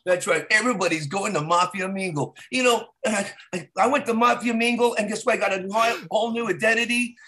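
hammed-up infomercial pitch; mock advert testimonial. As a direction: sell it like a satisfied customer. Spoof testimonial for mafia dating service.

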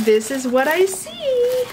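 high, slow sing-song lilt. Real, not acted. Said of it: "I'm sorry for the sing-song voice".

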